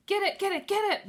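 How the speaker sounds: high, loud whiny moan; bored moaning. A narrator's reading. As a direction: whine like you're dying of boredom. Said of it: "moaned Bean".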